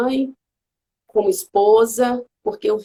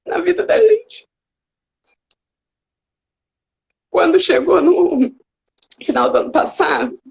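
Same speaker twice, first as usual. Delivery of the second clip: real tears rising in a high voice; halting, tearful, pushing the words out; choked up, crying; 6 semitones higher, 4 dB louder than usual. Real, not acted. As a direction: overcome with emotion mid-sentence. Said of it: "eu fico até emocionada".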